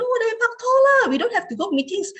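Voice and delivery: high whiny plea to skip meetings; pleading with boyfriend. Retold or imitated. Girlfriend begging her boyfriend to just date instead, high and whiny. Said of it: "一時一時我都會feel very like".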